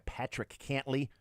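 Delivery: a slip into an odd unnamed accent on "Patrick Cantlay". Shown as a demonstration. Host reading golf pairings, slipping into an odd accent. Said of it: "I don't know why I went in that, uh, uh, accent".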